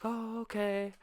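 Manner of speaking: very high, small imitation; mock meek girlfriend. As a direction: squeak a put-on meek okay.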